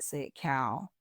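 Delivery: ordinary delivery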